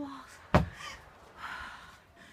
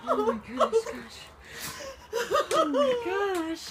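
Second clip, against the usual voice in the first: laughing through tears, stunned; sobbing disbelief; crying in shock; 7 semitones higher, slower than usual. Real, not acted. House damaged, shocked crying.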